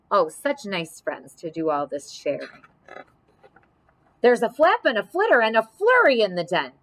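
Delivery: ordinary delivery, nothing marked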